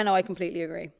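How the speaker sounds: ordinary delivery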